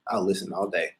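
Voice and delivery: usual speaking voice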